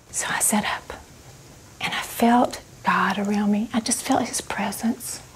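hushed and unvoiced, as if in prayer; reverent whisper. Real, not acted. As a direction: whisper it in awe. Sensing God's presence.

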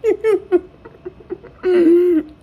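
high-pitched, loud burst of laughing; helpless high laugh at the clip; real laughter. Real, not acted. Reacting to funny clip.

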